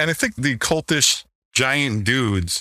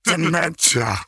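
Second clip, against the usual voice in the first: higher, drawn-out mock wrestler voice; Damien's mispronunciation; 7 semitones higher, slower than usual. Mocking how Damien Demento says his own name, higher. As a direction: say the name like he botched it. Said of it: "I like how he says his name".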